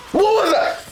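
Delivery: sudden high frightened yelp with a laugh; startled squeal, half laughing; jump-scare shriek. Shown as a demonstration. Scaredy cat jolted by a jump scare, shrieking high. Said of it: "react like this to a jump scare".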